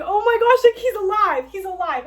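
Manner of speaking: high, loud squeal of relief; overjoyed. Acted. Heroine realizing Van Helsing survived, squealing with joy. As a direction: burst out in joyful disbelief. Heroine finds him alive.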